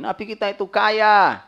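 high, loud sarcastic echo of their claim; mocking quote. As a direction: parrot the claim back, mocking. Mimicking people's wealth claim.

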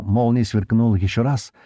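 plain reading voice

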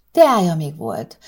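ordinary delivery, nothing marked

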